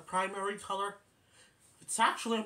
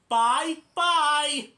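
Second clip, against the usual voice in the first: much higher and louder chipmunk bye-bye; Alvin's bye-bye; 11 semitones higher, 12 dB louder than usual. Retold or imitated. Alvin cheekily copying goodbye, high loud chipmunk squeak.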